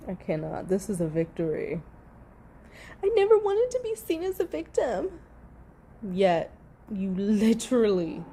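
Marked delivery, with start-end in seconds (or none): 3.0-5.2 high mocking voice of the actress; mocking impression. Streamer mocking the actress's testimony, high voice. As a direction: squeak it out in mock innocence.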